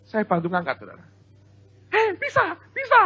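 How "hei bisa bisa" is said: put-on very high, gleeful kid's cry; excited child. Child thinks he lifted it, high delighted squeal. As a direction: squeal like a kid who just did it.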